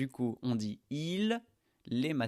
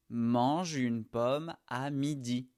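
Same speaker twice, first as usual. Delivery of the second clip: slow liaison demo; slower than usual. Shown as a demonstration. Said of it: "tu vas connecter le son je à une".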